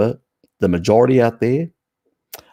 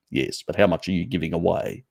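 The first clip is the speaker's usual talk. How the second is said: ordinary talk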